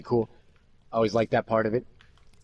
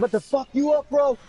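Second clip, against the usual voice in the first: high-pitched mock jock aggression; hammed-up angry challenge, high; confrontational jock; 12 semitones higher than usual. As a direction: imitate a bro squaring up for a fight. Jock confronting him after chase.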